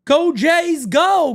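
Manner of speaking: high, loud fan cheer; chat shout. Retold or imitated. Reading a Jays fan's message aloud, hammed-up cheer. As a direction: belt it like a rowdy Jays fan. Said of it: "He says".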